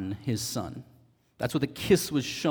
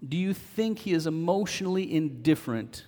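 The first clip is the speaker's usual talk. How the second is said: slow, emphatic sermon appeal, pitched up; earnest preaching; 4 semitones higher, slower than usual. Real, not acted. Preacher assuring believers they are God's own, slow and emphatic. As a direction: press each phrase home with long pauses. Preacher addresses the congregation directly.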